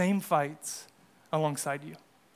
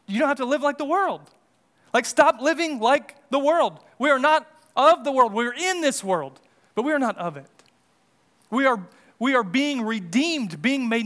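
higher, louder exhortation to the congregation; impassioned preaching; 6 semitones higher, 4 dB louder than usual. Real, not acted. Preacher urging the congregation not to live like the world, impassioned preaching. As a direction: preach it insistently, pitch raised.

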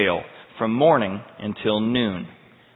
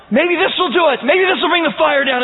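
frantic hope; 13 semitones higher, 6 dB louder than usual. Acted. Prophets' frantic hopes acted out.

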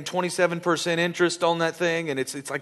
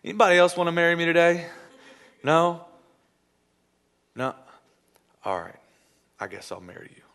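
bored indifference. Acted. Bride shrugging off the groom, bored. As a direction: settle for him with a bored sigh. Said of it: "with no emotion".

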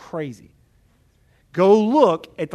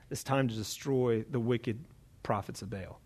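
low, hushed verdict; quiet grave pronouncement; 7 semitones lower, 11 dB quieter than usual. Acted. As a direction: drop low and soft, as a sentence passed. Elijah's grave sentence on prophets.

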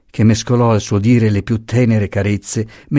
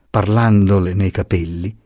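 ordinary delivery

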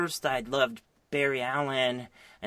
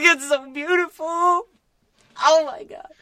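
much higher, louder hammed-up teary gush; mock weepy; 10 dB louder than usual. Admitting to a friend he cried, gushing in a mock weepy voice. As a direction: fake sobbing over the romance. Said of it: "I was like".

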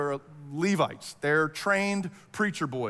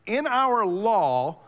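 raised, louder, halting interjection; Nicodemus; 6 semitones higher, 6 dB louder, much slower than usual. Retold or imitated. Nicodemus to bickering Pharisees and officers, interjecting. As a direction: cut into the bickering, then slow right down. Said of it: "just kinda throws it out there".

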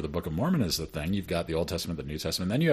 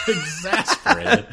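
laughing; 9 semitones higher, 8 dB louder, slower than usual. Real, not acted. Laughing about Sunday school teachers.

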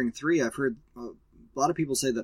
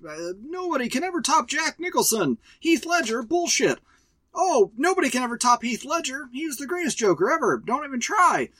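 high mock fanboy whine, hammed-up; mock the know-it-all fans in a higher voice; a hammed-up higher nagging fan voice; 9 semitones higher than usual. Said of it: "people that are like".

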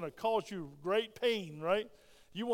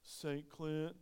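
timid roll-call answer; 5 semitones lower, 10 dB quieter than usual. Pretend. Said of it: "you go".

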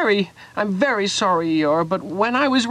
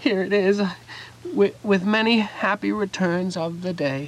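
sniffling; 4 semitones lower, 4 dB quieter than usual. Acted. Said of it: "sniffing a little".

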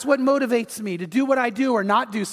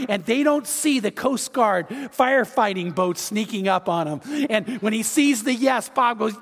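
normal talking voice

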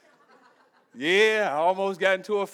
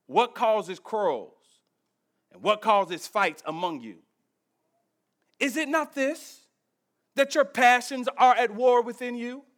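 measured, deliberate scripture reading, building in force; 4 semitones higher, 4 dB louder than usual. Acted. Pastor reading James to the congregation, measured. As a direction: read the verse like it matters.

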